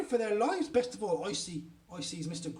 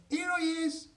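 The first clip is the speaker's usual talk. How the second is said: high, sing-out call; 10 semitones higher, 3 dB louder than usual. A narrator's reading. Invisible boy answering his mummy, high calling voice. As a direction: pipe up to be found.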